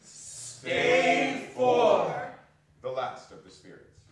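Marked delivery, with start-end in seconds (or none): 0.0-2.3 called out high and loud; loud announcement. Acted. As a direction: announce the stave like a herald. Announcing the next stave.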